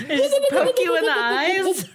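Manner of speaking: laughing. Real, not acted. Three Stooges joke, laughing.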